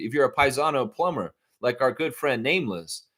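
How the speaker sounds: ordinary delivery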